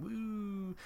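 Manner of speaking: high, soft, drawn-out woo; quiet high-pitched coo; mock floating "woo". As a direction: let out a small high woo. Imitating dad floating in water.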